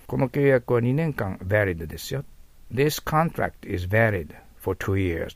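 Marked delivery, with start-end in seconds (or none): 2.7-5.3 slow, careful English read for learners; slow model sentence. Shown as a demonstration. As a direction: read it slowly, word by word for students. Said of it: "となります".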